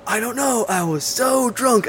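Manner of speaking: high, slow put-on drunk voice; hammed-up sloppy drunk, stretching it out; Kurt Russell's reply. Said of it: "they had the whole thing".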